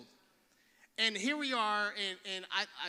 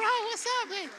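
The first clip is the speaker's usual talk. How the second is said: much higher, loud hype greeting; louder hype greeting; 13 semitones higher, 7 dB louder than usual. Real, not acted. Preacher re-greeting the teen, higher and louder. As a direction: crank the greeting way up.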